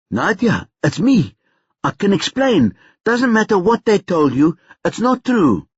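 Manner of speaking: high, anxious rush to explain; urgent pleading. A narrator's reading. Brother explains himself to his sister, pleading. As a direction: plead to be believed, pitch climbing.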